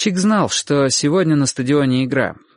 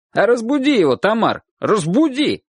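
high, insistent call; 10 semitones higher than usual. A narrator's reading. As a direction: urge the neighbour from up on the roof. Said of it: "крикнул один из мужчин". (настойчивый крик с крыши)

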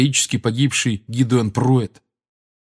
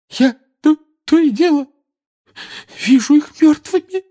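high, loud tearful lament; Molly complaining bitterly to Lupin; bitter complaint; 7 dB louder than usual. A narrator's reading. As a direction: pour out grief through tears. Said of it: "горько жаловалась миссис Уизли".

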